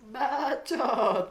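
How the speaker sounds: high, loud squeaky cartoon voice; a quick, high, snappy cartoon retort; SpongeBob saying shut up. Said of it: "Dia bilang kayak".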